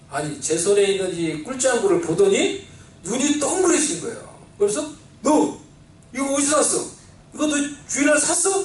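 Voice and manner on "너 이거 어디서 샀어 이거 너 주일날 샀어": mother's scolding. Retold or imitated. Mother confronting her son over a Sunday snack, higher sharp questioning. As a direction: interrogate the child like a shocked mother. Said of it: "딱 그러는 거예요".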